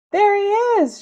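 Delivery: high, loud shout; angry old ladies shouting. Acted. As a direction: yell and point him out. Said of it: "shouted a couple ... angry old ladies".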